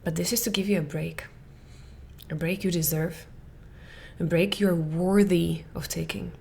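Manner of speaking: gentle, hushed, comforting; a quiet caring permission; soft reassurance. Shown as a demonstration. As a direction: soothe her tired listener softly. Reassuring the listener.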